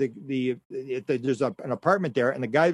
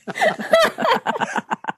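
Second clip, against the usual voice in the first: high, loud burst of real laughter; 5 dB louder than usual. Real, not acted. The host cracks up after the big-teeth joke, laughing loudly.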